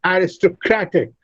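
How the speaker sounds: "Aristocratic" said a-ris-to-CRAT-ic; stress on CRAT. Shown as a demonstration. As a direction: main stress on CRAT in "Aristocratic", lighter on the first syllable. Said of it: "Kra receives the fourth".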